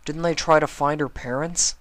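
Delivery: put-on a curious kid asking fast; little dragon voice. Spike asks.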